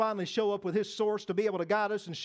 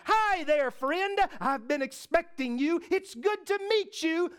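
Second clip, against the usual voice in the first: high, friendly call of welcome; acted-out excited hello; eager greeting; 6 semitones higher, slower than usual. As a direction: greet a long-awaited friend, pitched up. Acting Joseph greeting God's messenger.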